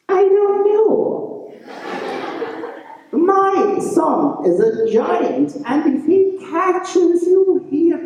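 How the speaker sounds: put-on high, slow old woman. A narrator's reading. Old woman warns Francie about her giant son, high and slow. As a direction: warn slowly in a high crone voice.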